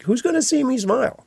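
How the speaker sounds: high, incredulous who's-gonna-see-me; incredulous singer. Retold or imitated. Singer questioning the producer's note to smile, high incredulous. Singer answering the booth's request.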